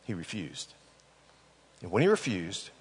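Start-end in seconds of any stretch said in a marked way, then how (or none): 0.1-0.7 hushed. Shown as a demonstration. Preacher repeats the refusal.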